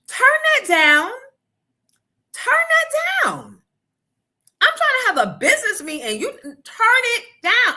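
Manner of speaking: loud, high yell over blaring TV. Retold or imitated. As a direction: shout them down over the noise. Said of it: "to having to yell".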